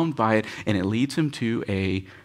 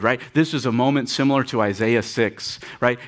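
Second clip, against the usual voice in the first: ordinary talk, nothing marked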